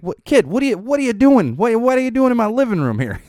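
shocked high-pitched shout; high surprised yelling. Retold or imitated. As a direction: yell in disbelief at the kids. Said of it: "I'm like".

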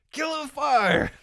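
high, loud kill-it-with-fire cry; mock outburst. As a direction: shout it in mock horror. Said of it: "over-the-top reaction".

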